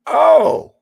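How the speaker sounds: high, loud startled oh; shocked gasp. Retold or imitated. Retelling a surprise subscription page, loud startled exclaim. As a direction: recoil in mock shock. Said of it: "I went, gasps".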